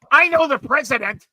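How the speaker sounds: very high, loud mock proclamation; indignant boast. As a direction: imitate an irate man shouting his connections. Retelling stranger pulling rank loudly.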